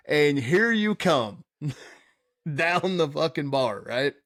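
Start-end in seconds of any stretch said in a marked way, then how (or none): none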